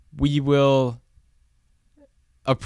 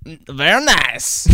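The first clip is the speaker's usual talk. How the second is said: slow Borat "Very nice"; drawn-out Borat accent; much slower than usual. Pretend. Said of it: "Borat voice".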